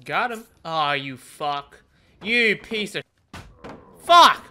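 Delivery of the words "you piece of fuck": explosive one-word yell; frustrated shout. Real, not acted. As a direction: blurt a furious curse. Missed opponent.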